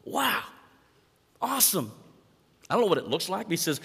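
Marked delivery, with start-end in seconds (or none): 0.0-1.9 high, bright exclaim of delight; excited praise. Acted. Master praising faithful servant, mock excited. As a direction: gush over the servant's work.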